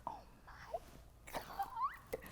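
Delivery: awed gasped whisper; stunned whisper. Retold or imitated. Owner recognizes her, stunned.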